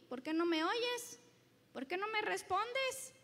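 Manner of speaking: loud, pointed retort turned back on the listener; God answering back. Acted. Preacher voicing God turning the complaint around, pointed. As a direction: throw the question back firmly. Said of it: "Y Dios dice".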